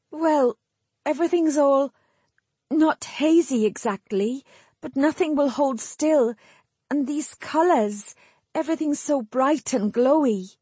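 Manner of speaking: high, halting voice of a dazed woman; struggling for words. A narrator's reading. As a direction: grope for each phrase, pausing between them. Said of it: "struggling with the unfamiliar task of forming sentences".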